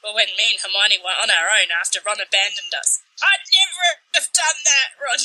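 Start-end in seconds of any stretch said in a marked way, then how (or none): 3.2-5.2 higher, hammed-up protest; mock-offended, pitch lifted; indignant. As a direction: protest it at a friend, half-laughing. Reads Harry's indignant protest to Ron.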